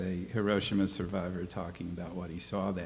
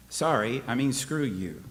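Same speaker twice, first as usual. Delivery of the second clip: normal talking voice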